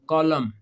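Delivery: "कॉलम" said as kolam, no n sound at the end; silent n in column. Shown as a demonstration. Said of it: "इसमें एन नहीं बोल रहा". ("कॉलम" को आखिरी एन के बिना बोलें)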